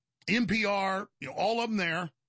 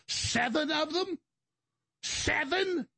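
incredulous; 8 semitones higher, much slower than usual. Real, not acted. Outraged disbelief at accusations.

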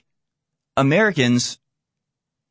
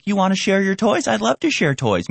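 put-on higher, eager childlike voice; naive child; 8 semitones higher than usual. Lightly voiced naive American as an innocent child offering to share. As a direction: sound like a trusting little kid. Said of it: "innocent, naive children".

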